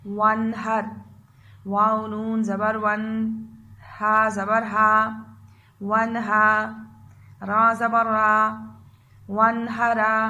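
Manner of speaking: slow, loud syllable-by-syllable spelling; slow spelling drill. Shown as a demonstration. Teacher drilling children through a word, slow spelling. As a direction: spell it out slowly so children repeat. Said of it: "मैं आपको याद करवाऊंगी".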